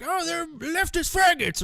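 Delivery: high-pitched mock complaint; mocking objector. Imitating somebody objecting to the tracker account, mocking high voice. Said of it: "Somebody's gonna be like".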